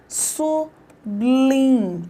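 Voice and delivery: SUBLIM split as su-blim, slowly; slow SU... BLIM, the b starting the second syllable; common mispronunciation. Shown as a demonstration. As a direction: say SUBLIM syllable by syllable, bl blended together. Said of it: "É comum você falar".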